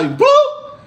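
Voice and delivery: high squeaky bloop sound. Acted. Price shooting up, a squeaky bloop sound. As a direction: pop it up high like a cartoon sound.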